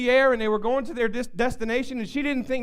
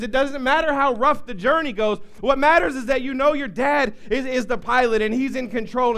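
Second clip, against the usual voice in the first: normal talking voice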